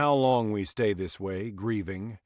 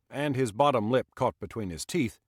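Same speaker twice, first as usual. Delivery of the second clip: ordinary delivery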